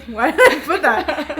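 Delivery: amused disbelief at herself; laughing self-question. Retold or imitated. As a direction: ask it through laughter. Rereading own odd text, laughing.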